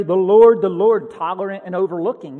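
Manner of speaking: loud booming, then stern; mock proclamation. Preacher mocking a wrong view of God, booming. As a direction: boom it out like a herald. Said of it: "He doesn't say".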